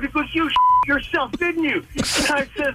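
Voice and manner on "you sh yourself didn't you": high, indignant mock rant; retold angry accusation. Buddy to driver in the car, mock-angry accusing. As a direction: imitate your furious buddy, pitched up. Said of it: "he's swearing at me like crazy".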